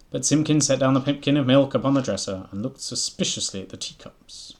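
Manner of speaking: loud, lively read-aloud narration; animated storybook narration; story reading. A narrator's reading. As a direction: read it out brightly to the listeners.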